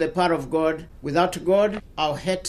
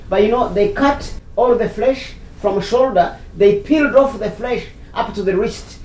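raised, higher voice, pressing the point; real agitated outburst; 4 semitones higher, 6 dB louder than usual. Real, not acted. Bishop tells woman how his niece was killed, agitated. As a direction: confront the listener with painful detail.

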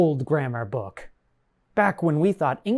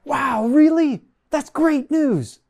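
excited; 9 semitones higher than usual. Acted. Mock delight at good news.

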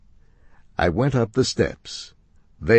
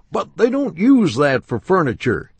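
high, loud, certain shopman; insistent upholsterer; 10 semitones higher, 6 dB louder than usual. Acted.

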